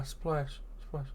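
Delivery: ordinary delivery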